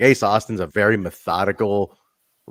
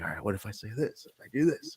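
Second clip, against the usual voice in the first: a hint of a methodical wrestler, much softer; musing wrestler; 11 dB quieter, faster than usual. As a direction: quietly think it through aloud, much softer. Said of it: "He's like".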